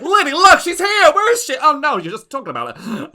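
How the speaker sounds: high, loud gushing diva; gloating aunt voice. Acted. Lady Ziza taunting the player at the dice table, high gushing diva. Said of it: "she's like".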